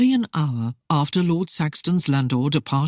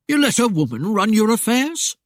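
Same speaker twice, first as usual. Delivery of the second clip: higher, louder rustic blurt, shocked; blurted in surprise; 6 semitones higher, 4 dB louder than usual. A narrator's reading. Mayor shocked that a wife runs affairs, blurted. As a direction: blurt it out, scandalised. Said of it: "Avery blurted in surprise".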